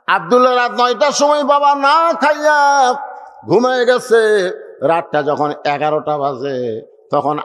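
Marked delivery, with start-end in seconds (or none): none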